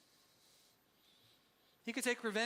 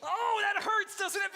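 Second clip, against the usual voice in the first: mock wince; 10 semitones higher, 6 dB louder, slower than usual. Real, not acted. Reacting to own punchline.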